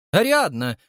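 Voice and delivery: high, loud appeal by name; exclamation. A narrator's reading. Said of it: "воскликнул старший из Нелюдовых". (громко восклицая)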